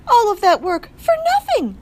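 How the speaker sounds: high, loud wail of wasted effort; dramatic mother. A narrator's reading. Mother mouse lamenting her lost litter, dramatic wail. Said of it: "said the mother".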